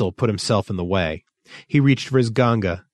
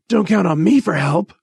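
high, louder testy refusal; 9 semitones higher, 5 dB louder than usual. A narrator's reading. Rolver declining to help Thistle, testily. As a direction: refuse with irritation. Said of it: "Rolver said testily".

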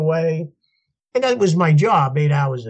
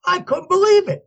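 high, excited burst of amazement; overjoyed and incredulous; thrilled disbelief. Retold or imitated. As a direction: relive the thrill, pitched way up. Retold joy at being cast.

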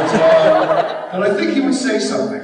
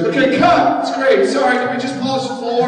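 calling cut; 10 semitones higher, 5 dB louder than usual. Retold or imitated. Director calls cut on set.

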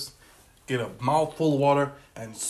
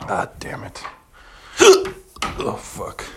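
hiccuping mid-curse; swearing through hiccups; 3 semitones lower, 11 dB louder, much slower than usual. Real, not acted. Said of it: "I got the fucking hiccup".

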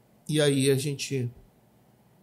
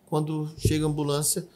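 ordinary talk

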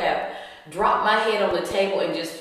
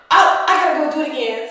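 fast, higher, louder; breathless excited hurry; excited rush; 6 semitones higher, 8 dB louder, much faster than usual. Retold or imitated. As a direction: blurt it out racing to the bathroom. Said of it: "And then I said".

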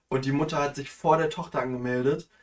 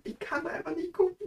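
high whine; 14 semitones higher, 4 dB quieter than usual. Real, not acted. Repeats the line in a high whine.

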